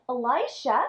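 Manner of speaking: ordinary delivery, nothing marked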